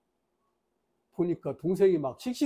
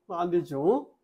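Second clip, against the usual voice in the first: ordinary delivery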